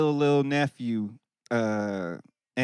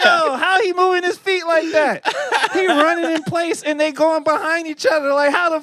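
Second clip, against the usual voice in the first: high, laughing disbelief at the dance; amazed disbelief; 11 semitones higher than usual. Retold or imitated. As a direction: marvel at it through laughter. Retelling his amazement at video.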